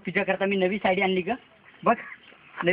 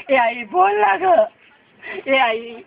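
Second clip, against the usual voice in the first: anguished plea; 2 semitones higher, 4 dB louder, much slower than usual. Acted. Son begging his dead mother to speak, wailing. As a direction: drag out the words, begging her to answer.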